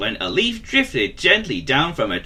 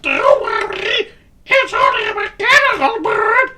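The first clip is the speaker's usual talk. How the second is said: squeaky cartoon voice; 6 dB louder than usual. Mister Nervous voiced.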